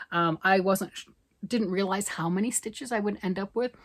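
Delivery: ordinary delivery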